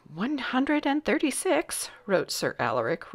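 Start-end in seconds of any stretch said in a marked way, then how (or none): 0.1-1.9 high, thin-volumed character voice; fretful counting courtier. A narrator's reading. Sir Alaric recording the hat count, fretful. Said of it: "wrinkling his brow".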